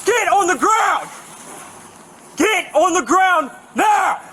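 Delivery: high, forceful police command; shouted surrender demands. Real, not acted. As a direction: bark the order to get down. Officer commanding suspect.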